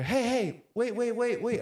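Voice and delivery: high, urgent imitation of a call; calling after someone. Lady calling to him on way to car, imitated. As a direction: mimic someone hurrying to stop him.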